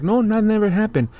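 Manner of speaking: high, sneering mock of a denier; dismissive parody of a liar; mocking denial. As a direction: mimic them brushing it off. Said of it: "these people just wanna act like".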